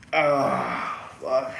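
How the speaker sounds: muffled through a rubber mask; inside a rubber mask. Real, not acted. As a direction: talk from inside a hot mask. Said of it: "it definitely got hot in the mask".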